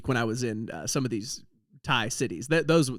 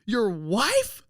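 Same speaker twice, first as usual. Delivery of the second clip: retold disbelief; 5 semitones higher than usual. Retold shock at friend's wife.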